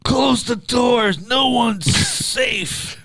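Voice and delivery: slow high-pitched yell; high falsetto shout. Pretend. Mock Taffer bar rescue yell.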